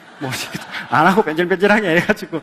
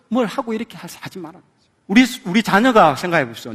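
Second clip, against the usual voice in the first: ordinary delivery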